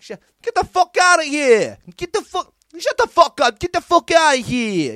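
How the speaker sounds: high, very loud mock yell in a bad American accent. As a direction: bellow at a man in the street, hamming it up. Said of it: "fucking yelled at him".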